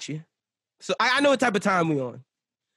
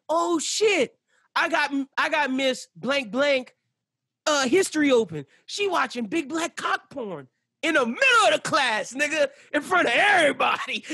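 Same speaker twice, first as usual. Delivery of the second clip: mock alarm; 8 semitones higher than usual. Fake alarm at teacher's screen.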